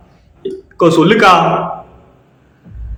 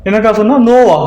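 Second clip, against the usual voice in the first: startled; 6 semitones higher than usual. Real, not acted. Startled by a ghostly sound.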